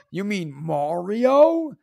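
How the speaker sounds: "Mario" drawn out as MAR-ee-oh, open "ah"; corrective pronunciation. Retold or imitated. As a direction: slowly query "Mario" with an "ah" vowel. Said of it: "he's like".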